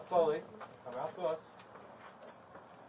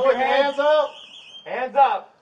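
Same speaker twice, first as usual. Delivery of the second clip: shouted command; 8 semitones higher, 7 dB louder, slower than usual. Real, not acted. Armed arrest commands.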